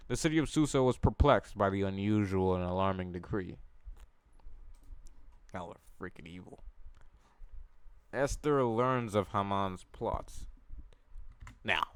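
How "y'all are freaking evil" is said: quiet aside. Real, not acted. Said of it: "reader's quiet aside".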